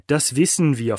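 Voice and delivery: loud, cutting snap; hissed snap. A narrator's reading. Boss cutting off the pleading captive, snapping. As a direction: spit the words at him. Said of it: "fauchte Kiel".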